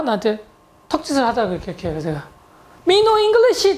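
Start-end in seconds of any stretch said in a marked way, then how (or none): 2.9-3.8 high-pitched, hammed-up non-English speaker; imitating broken English. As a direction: blurt it high, pretending to know no English. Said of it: "영어도 한마디도 못한다". (영어 못하는 척 높은 목소리로)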